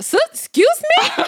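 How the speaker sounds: very high mock-offended question; hammed-up indignation, pitch shooting up; indignant. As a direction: squeal it in playful disbelief. Indignant reply to being scolded.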